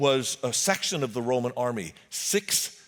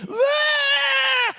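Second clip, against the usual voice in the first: demon scream; 4 dB louder than usual. Acted. Demon-possessed man charging down hill.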